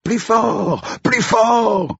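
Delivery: put-on very high-pitched kids' cheer; high, eager child chant; children shouting. A narrator's reading. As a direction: cry out like excited children. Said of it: "Les enfants criaient".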